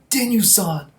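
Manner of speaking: high, loud, slow cry of guilt; hammed-up grieving soldier, slowed down; anguished plea. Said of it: "Kanto's like".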